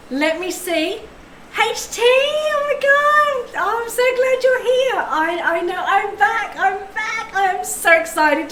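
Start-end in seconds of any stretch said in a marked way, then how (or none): 1.6-8.5 much higher, slow delighted squeal; thrilled greeting. Real, not acted. Streamer welcoming a returning viewer back, high delighted squeal. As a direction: gush with real joy at seeing them.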